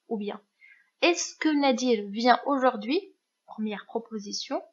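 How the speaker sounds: ordinary talk, nothing marked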